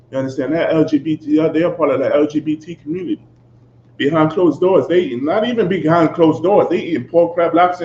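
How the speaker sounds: ordinary talk, nothing marked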